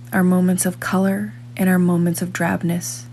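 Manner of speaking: ordinary talk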